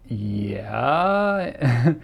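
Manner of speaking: long drawn-out, rising yeah; hesitant yes. Retold or imitated. Replying to a stranger who recognized him, wary. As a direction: stretch it out as an unsure admission.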